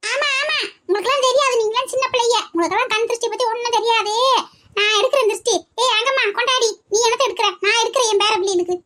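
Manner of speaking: high-pitched, bossy granny voice; bossy old woman. Acted. Grandmother insisting to the kids, high and bossy. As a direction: play the know-it-all elder woman.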